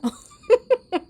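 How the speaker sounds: a loud high burst of laughter. Real, not acted. Vlogger laughing at herself arguing with cats, loud high laugh. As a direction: crack up at yourself.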